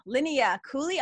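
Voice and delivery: normal talking voice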